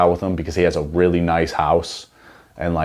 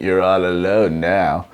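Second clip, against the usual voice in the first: villain line; slower than usual. Retold or imitated. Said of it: "And she's like".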